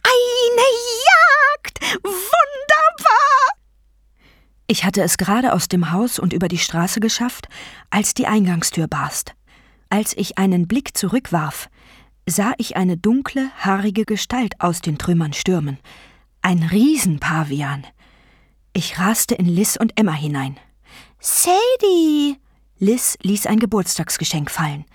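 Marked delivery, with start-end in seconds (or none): 0.0-3.5 very high, loud, stretched shriek; screeching vulture goddess. A narrator's reading. Vulture goddess shrieking after her fleeing prey, screeching. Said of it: "Nechbet kreischte hinter mir her". 21.2-22.4 high, falling cry of a name; startled call. Acted. Friend run into by fleeing Sadie, calling out startled.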